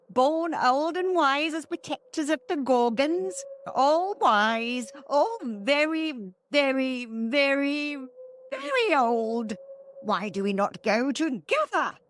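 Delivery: high, cracked crone voice; high witch voice dragging out the verys; old hag voice. Acted. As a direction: croak it out like an ancient witch. Hag voice, strongly shifted.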